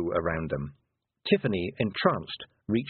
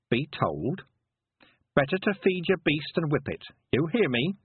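put-on higher-pitched rustic old woman, firmly warning; stern country Granny; 6 semitones higher than usual. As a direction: lay down the law as a country granny. Granny warns the pot seller.

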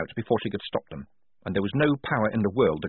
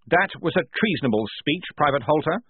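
raised, formal reprimand; officious lieutenant; 6 semitones higher than usual. Acted. Blouse rebuking a private for treason, officious. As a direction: scold by the rulebook, pitch up.

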